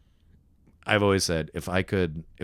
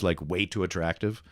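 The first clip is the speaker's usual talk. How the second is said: ordinary talk, nothing marked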